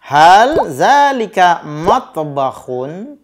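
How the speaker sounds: teacher quizzing the class slowly; slow drill question. Shown as a demonstration. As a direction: ask slowly, the question clearly marked. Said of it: "kata tanya hal".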